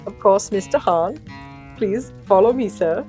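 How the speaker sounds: loud, giddy elf host. Acted. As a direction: usher him along like an eager elf. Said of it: "the elf pranced away giddily".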